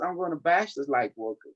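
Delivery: ordinary talk, nothing marked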